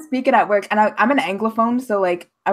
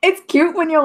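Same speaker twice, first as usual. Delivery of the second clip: usual speaking voice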